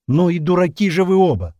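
high, loud outburst; exasperated. A narrator's reading. Captain calls both stubborn men fools, exasperated. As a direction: scold them in a flash of temper. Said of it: "добавил он в сердцах".

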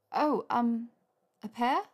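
hesitate, then answer as a question; flustered hesitation. A narrator's reading. Hermione chooses pear or olive, flustered and hesitant.

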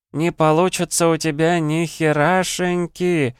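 high, slow singsong taunt; sweet chirping. A narrator's reading. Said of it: "с милой улыбочкой прощебетала".

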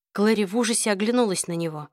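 ordinary delivery, nothing marked

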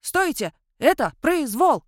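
громкий крик на бегу, выше обычного; вопи, убегая от погони; вопит на бегу. A narrator's reading. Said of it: "вопил он на бегу". (вопит на бегу, громко и высоко)

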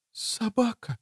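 very soft, high, pitiful whisper; faint, plaintive girl's whisper. A narrator's reading. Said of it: "жалко прошептала она".

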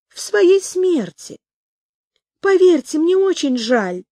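high-pitched, drawn-out condolence; soft yet merciless youngster. A narrator's reading. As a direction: pity them slowly in a high little voice. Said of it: "мягкие и безжалостные". (мягко и безжалостно, высоким голосом малыша)

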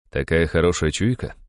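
usual speaking voice

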